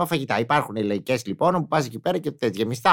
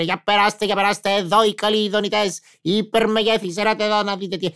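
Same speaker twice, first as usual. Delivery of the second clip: market vendor calling out; 6 semitones higher than usual. Retold or imitated. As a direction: call out wares like a street vendor.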